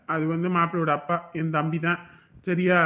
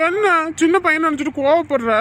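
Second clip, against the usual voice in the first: indignant, much higher voice; high-pitched protest; 7 semitones higher, 4 dB louder than usual. Acted. Caller objecting to being scolded like a small boy, high indignant protest. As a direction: protest as if treated like a kid.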